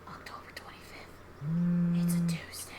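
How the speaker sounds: hushed whisper. Acted. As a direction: whisper the date slowly. Reads date card quietly.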